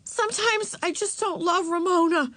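put-on high, sudden girlish outburst; blurted confession. A narrator's reading. Girl confessing to mother and aunt, blurting. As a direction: blurt it out to be done with it. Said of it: "she blurted out".

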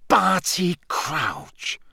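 name him slowly in disbelief; stunned recognition. A narrator's reading. Snape seeing the man on the floor, stunned exclamation.

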